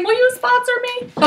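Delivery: high-pitched mock-humble request; playful awed plea. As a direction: ask it high, as a joke. Joking plea, acting out her awe.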